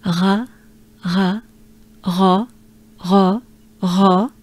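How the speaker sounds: "ra" repeated with a breathed French R. Shown as a demonstration. Teacher drills pronouncing the R, on a breath. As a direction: let each "ra" out on a breath. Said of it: "let's take a good breath".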